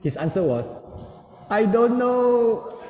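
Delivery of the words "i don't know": high, very slow mock wail; drawn-out, put-on tearful voice; imitated crying child. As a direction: mimic a little boy wailing. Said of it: "When my son looked at me crying".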